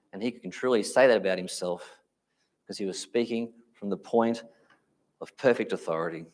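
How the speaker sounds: usual speaking voice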